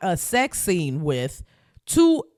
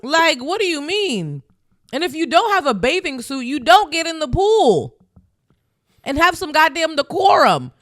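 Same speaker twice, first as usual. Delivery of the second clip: exasperated scolding; 6 semitones higher than usual. Real, not acted. Co-host lecturing the absent guest on decorum, exasperated. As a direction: lay down the rules in comic outrage.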